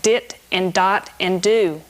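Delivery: dit, dot, do spoken slowly, each syllable crisp and separate; articulation syllables. Shown as a demonstration. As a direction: sound out dit and dot and do like a band director. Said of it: "talk about articulations in terms of syllables".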